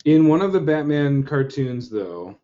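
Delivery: normal talking voice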